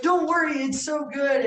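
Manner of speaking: enthusiastic reassurance. Retold or imitated. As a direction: imitate a friend gushing encouragement. Retold friend hyping up the food.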